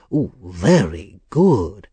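lightly voiced old schemer, slow; slow, drawn-out approval. A narrator's reading.

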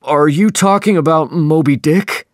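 a lightly voiced sailor asking; questioning sailor. A narrator's reading. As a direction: ask it surprised, as a crewman. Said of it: "asked Testego".

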